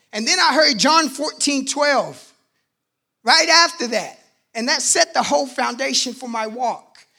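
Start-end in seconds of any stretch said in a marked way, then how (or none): none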